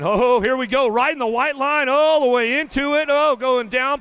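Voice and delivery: high, loud burst of race excitement; excited. Real, not acted. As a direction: call the crash like it just happened. Crash seen live by announcer.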